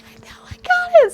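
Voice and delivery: very high breathy squeal; whisper-squeal with glee; giddy whispered squeal. Retold or imitated. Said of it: "I was like".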